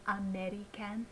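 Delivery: "American" with all four syllables clearly sounded; over-careful "American", a-meh-ree-CAN; every syllable enunciated. Shown as a demonstration. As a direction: say "American" ending in a full "can", nothing reduced. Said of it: "how clearly I spoke every syllable".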